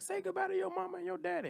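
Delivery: high yelled drill sergeant impression. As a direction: bark it like a boot camp sergeant. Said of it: "they used to have a sergeant come out like".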